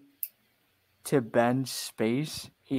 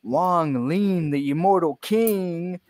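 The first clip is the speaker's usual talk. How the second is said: announcer voice; 3 semitones higher, 3 dB louder than usual. Pretend. Hype introduction of fighters.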